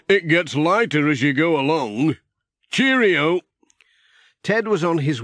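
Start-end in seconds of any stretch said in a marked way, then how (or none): 0.1-3.4 cheery Ted. Acted. Ted voiced in story.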